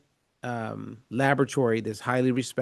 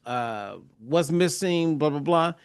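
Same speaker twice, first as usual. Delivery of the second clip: ordinary delivery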